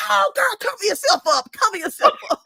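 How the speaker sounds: high, laughing mock dad; hammed-up dad shooing her, voice pitched high; dad reacting. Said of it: "He was like".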